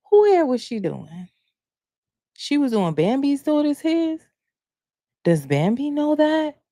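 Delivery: high, rising gossip questions; incredulous questioning. Acted. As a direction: question it as if you can't believe it. Acts out reaction to gossip.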